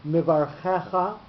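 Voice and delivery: mivarchecha slowly, stress on the che before final cha; second-last syllable stressed. Shown as a demonstration. Teacher giving an example of a mil el word, mivarchecha stressed on che. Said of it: "the second last syllable, the che".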